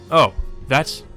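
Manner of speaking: ordinary talk, nothing marked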